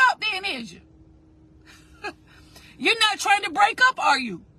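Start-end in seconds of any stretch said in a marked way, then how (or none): none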